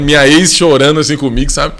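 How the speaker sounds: normal talking voice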